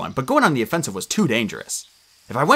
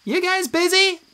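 high, squeaky, teasing duh; squeaky nymph. A narrator's reading. Tree nymph teasing the demigods, high and squeaky. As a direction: roll your eyes at them. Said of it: "Juniper asked".

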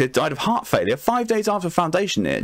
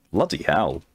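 ordinary talk

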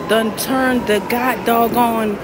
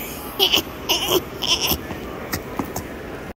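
laugh into a cry; 7 semitones higher, 6 dB quieter than usual. Real, not acted. Amazed at the room.

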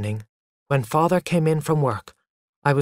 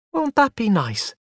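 higher, smiling mother's voice; pleased, indulgent lilt, pitched up; 9 semitones higher than usual. A narrator's reading. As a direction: ask it with an audible smile. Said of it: "she said with a smile".

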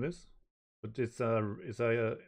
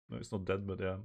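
ordinary delivery, nothing marked

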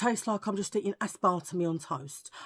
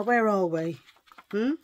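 normal talking voice

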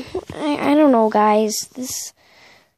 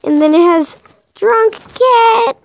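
higher, louder, slow and drawn out; excited reveal; 8 semitones higher, 5 dB louder, slower than usual. Real, not acted. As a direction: build up the big punchline.

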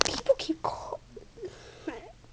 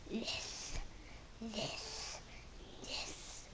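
whisper; 15 dB quieter, much slower than usual. Real, not acted. Showing himself to the camera.

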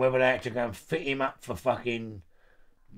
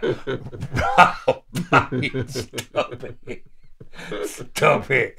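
laughing; 4 dB louder, much slower than usual. Real, not acted. Laughing retold terror.

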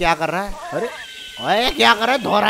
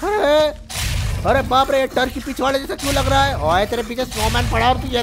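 loud, high-pitched exclamations; startled outcry; 5 semitones higher, 3 dB louder than usual. Real, not acted. Player startled mid-game with the turkey, alarmed. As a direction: cry out in comic alarm.

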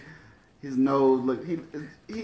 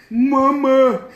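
loud high moaning Mama; dusty mummy rasp; 12 semitones higher, 12 dB louder than usual. Retold or imitated. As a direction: moan it like a mummy. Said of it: "sounded like a mummy".

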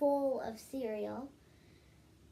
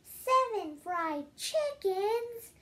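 much higher, louder, slow outraged question; shocked yell; 10 semitones higher, 6 dB louder, much slower than usual. Acted. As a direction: shriek it back in disbelief. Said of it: "yelled his mom".

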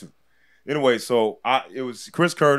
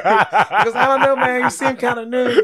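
lilting, higher imitation of Chris, laughing through it; high soft dancer voice; 11 semitones higher, 5 dB louder, slower than usual. Comic retelling Chris turning him down, light high voice. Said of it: "Chris's voice sounds like he's a really good dancer".

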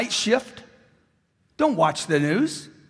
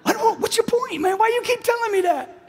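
exasperated retort; 12 semitones higher than usual. Retold or imitated. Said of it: "I'm like".

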